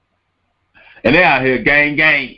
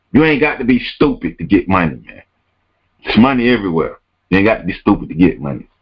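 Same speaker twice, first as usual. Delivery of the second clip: ordinary talk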